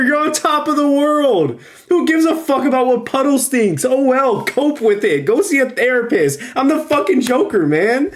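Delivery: high, excited, all-in hype; hyped-up rant. Pretend. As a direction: pump him up like a fan cheering on a villain. Ranting as the Joker should.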